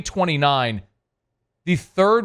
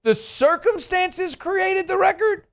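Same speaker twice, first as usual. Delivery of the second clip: mocking disbelief; 13 semitones higher, slower than usual. Real, not acted. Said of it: "did I hear that right".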